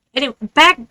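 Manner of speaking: ordinary delivery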